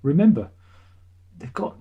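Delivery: usual speaking voice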